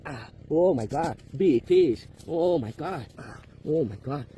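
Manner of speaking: loud, thrilled yelling at a catch; excited shouting. Real, not acted. Big fish being reeled in.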